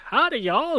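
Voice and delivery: high Southern howdy; southern accent. Retold or imitated.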